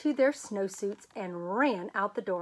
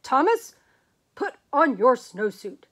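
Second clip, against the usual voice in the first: firm, louder command; stern principal; 7 dB louder than usual. Acted. Principal ordering Thomas, stern and loud. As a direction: lay down the law, no nonsense. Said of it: "his very best principal look".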